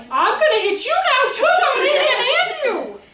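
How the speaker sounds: high, loud furious laughing threat; mock fury. Speaker mimics her inner rage during yoga, high loud laughing. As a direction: rant like you're about to snap. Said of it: "And I'm thinking".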